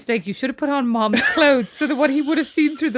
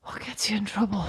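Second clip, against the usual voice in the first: whispered thinking; 7 semitones lower, 10 dB quieter than usual. Real, not acted. Muttering to herself, thinking.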